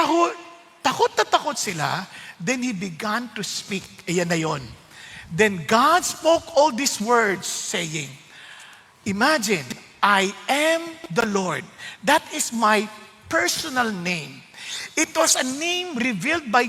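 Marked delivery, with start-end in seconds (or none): 5.3-11.6 slow, softer, lower reading of the verse; hushed solemn reading. Acted. Preacher reading God's first words to the church, hushed and slow. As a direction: read slowly in reverent hush. Reads verse slowly, softly.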